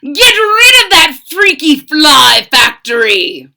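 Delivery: high, loud demanding doctor; loud mad doctor command; mad scientist order. Acted. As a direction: boss the monster around.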